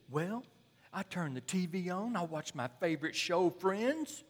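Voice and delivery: high and loud, pleased with himself; lazy husband. Retold or imitated. Husband proudly telling the counselors about his TV evening, high and loud. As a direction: list the evening like it's perfectly fine. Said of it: "husband describing his evening".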